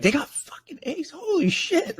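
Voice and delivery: high-pitched incredulous squeal, reenacted; stunned disbelief. Fan retelling his reaction to the commitment news, high stunned squeal. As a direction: retell it as a squeaky, blown-away outburst.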